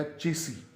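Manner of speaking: चेसी ending on ee, no s; correct pronunciation. Shown as a demonstration. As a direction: land on "CHE-see". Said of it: "तो ये बन जाएगा". (चेसी, आख़िर में लंबी "ई")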